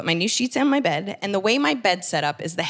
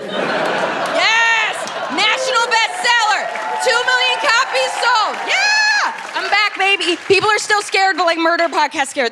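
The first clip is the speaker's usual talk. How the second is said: high, loud victory yells; triumphant shouting; 12 semitones higher, 6 dB louder, slower than usual. Acted. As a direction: cheer your own success like a champion. Triumphant comeback in her fantasy.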